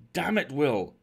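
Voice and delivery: loud, high outburst; impassioned exclamation; full of feeling. Acted. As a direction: burst out urgently to a shipmate. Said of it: "I said, full of many feelings".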